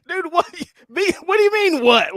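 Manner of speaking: high, incredulous what-the-heck; stunned disbelief. Retold or imitated. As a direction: act out your own jaw-drop on hearing it. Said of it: "I was like".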